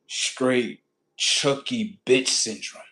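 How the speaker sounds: usual speaking voice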